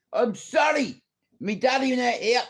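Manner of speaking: high, hammed-up wail; mock pleading apology. Judas namesake apologising to God, mock pleading. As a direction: plead upward like a sorry child.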